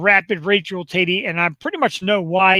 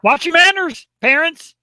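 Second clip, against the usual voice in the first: mock stern warning; 9 semitones higher, 5 dB louder than usual.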